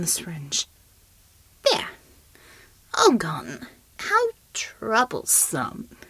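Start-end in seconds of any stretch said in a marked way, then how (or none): 1.7-6.0 slow, whispered mock of a wounded shapeshifter; sinister whisper. Shapeshifter dismissing the syringe wound in a slow whisper. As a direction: breathe the words out, bored and menacing.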